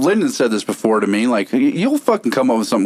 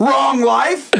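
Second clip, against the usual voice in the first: heel promo shout; 12 semitones higher than usual. Pretend. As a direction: scream it at the crowd like a heel. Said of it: "If I'm a heel".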